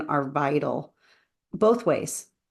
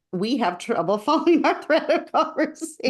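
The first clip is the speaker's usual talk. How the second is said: higher, laughing through the words; 7 semitones higher than usual. Real, not acted. Admitting to the audience they lose the thread, laughing. Laughs at their own trouble.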